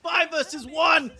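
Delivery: high, loud excited esports shout; live play-by-play. Acted. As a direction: call the fight at full hype.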